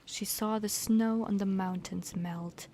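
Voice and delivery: usual speaking voice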